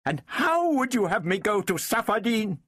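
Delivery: high, indignant challenge; high-pitched woman's outrage; furious cry. A narrator's reading. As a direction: throw the question at him, high and heated. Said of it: "she cried furiously".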